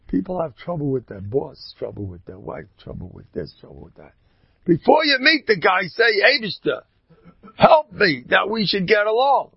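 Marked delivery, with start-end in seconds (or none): 4.7-9.5 high, loud preaching voice; a raised, urgent sermon call; fervent preaching. Acted. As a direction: rouse them to pray before every meeting. Urging the listeners at full force.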